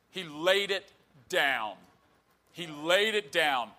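slow, weighty preacher's declamation; emphatic preaching. Real, not acted. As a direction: draw out each word with deliberate pauses.

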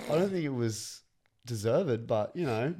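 ordinary delivery

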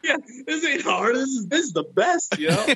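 high, mock-carefree soldier; carefree mock boast. Soldier mocks the idea of a hardship tour, carefree. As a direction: play it like nothing here is hard.

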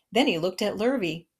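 usual speaking voice

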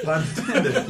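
high, loud, amused repeat; real laughing. Real, not acted. Laughing at the listener's pun.

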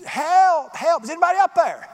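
high, loud cry for help; hammed-up panicked shouting; desperate holler. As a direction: holler up from the bottom of a cliff. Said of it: "And he hollers".

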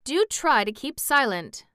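a touch of nervous urging. A narrator's reading. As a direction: urge them to hush, a little on edge.

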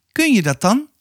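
high-pitched woman character asking; questioning woman. A narrator's reading. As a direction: question the child in a lifted woman's voice. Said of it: "vroeg de vrouw".

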